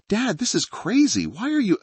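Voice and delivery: put-on scared teen girl. Daughter protesting, scared.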